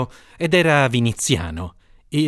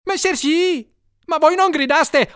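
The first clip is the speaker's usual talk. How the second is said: much higher, flustered servant voice; frightened cook finding a clever excuse, much higher; dismayed servant; 12 semitones higher than usual. A narrator's reading. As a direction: answer in a rush, almost bewildered. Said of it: "quasi sbigottito".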